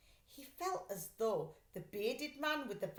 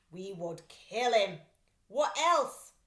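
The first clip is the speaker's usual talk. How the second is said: much louder threat; menacing thief; 9 dB louder, slower than usual. Acted. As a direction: say it like a boastful villain. Thieves threaten.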